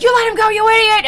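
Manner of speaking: very high, loud cartoon scolding; mock angry yell. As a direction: screech blame at a bungling partner. Acted demons quarrelling.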